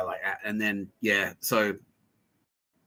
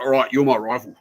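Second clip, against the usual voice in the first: lightly mocked, louder self-declared rival; 2 semitones higher, 7 dB louder than usual. Man declaring himself a rival, mock bluster. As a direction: announce yourself as a rival, a mild imitation.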